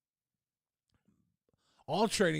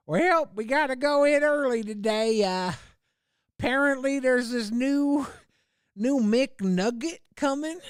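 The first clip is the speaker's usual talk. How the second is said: higher, louder put-on voice of a fed-up fast-food worker; mock tired worker; 9 semitones higher, 3 dB louder than usual. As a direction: play a grumbling worker on an early shift, pitched up.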